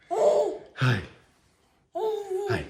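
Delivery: very high cooing hi. Real, not acted. Dad greeting his baby, very high coo.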